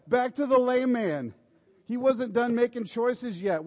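loud, high, ringing preacher's call; full-force preaching. Acted. Pastor urging the congregation to be thankful, loud and high preaching. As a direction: drive it home to the whole congregation.

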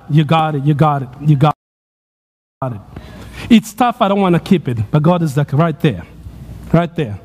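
a low, steady spotter talking someone through a hard lift; encouraging coach. Acted. Coaching Ben through a struggle.

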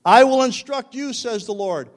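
high, loud, ringing declaration of scripture; full-force preaching. Real, not acted. Preacher closes on God's perfect way, preaching at full force. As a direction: proclaim each line with rising fervour.

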